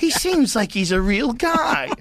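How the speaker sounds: high, slow, put-on old-timey voice; old movie voice. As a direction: marvel like a 1940s moviegoer. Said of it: "everybody's like".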